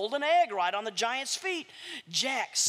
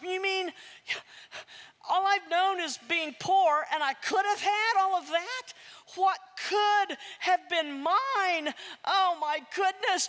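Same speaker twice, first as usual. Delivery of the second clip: stunned Jack; 7 semitones higher than usual. Acted. Jack to the woman, in shocked disbelief. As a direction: gasp it out as a boy who just learned he was robbed.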